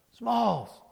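high holler that falls away; calling out. Retold or imitated. Benny calls Smalls from home plate, hollering. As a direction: shout a name across a ball field.

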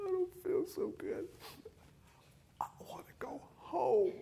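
put-on very high, crying child's whine sinking to a whisper; crocodile tears. A daughter crying to her dad on the road trip. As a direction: wail it like a little girl faking tears. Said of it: "she's crying big crocodile tears".

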